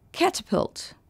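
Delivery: "Catapult" with the weight on CA; stress on first syllable. Shown as a demonstration. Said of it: "L'accent tonique est sur la première syllabe". (« Catapult » accentué sur la première syllabe)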